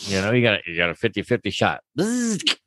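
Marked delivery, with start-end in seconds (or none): 2.0-2.6 high buzz into a mock gunshot; imitated gun noise. Playing guns and scaring the dogs, imitated gun noise. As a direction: make a playful gun sound effect.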